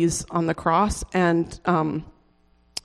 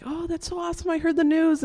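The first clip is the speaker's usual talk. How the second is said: gushing classmates; 9 semitones higher than usual. Retold or imitated. Classmates' reaction imitated.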